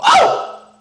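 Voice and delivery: very high, loud delighted squeal; high swoon cry. Real, not acted. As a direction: squeal like you just melted. Swooning at his line.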